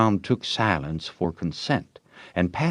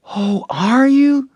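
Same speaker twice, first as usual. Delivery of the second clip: put-on high, slow, wide-eyed girl; breathless girl voice, delighted; girlish surprise; 7 dB louder, slower than usual. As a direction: gasp it out impressed.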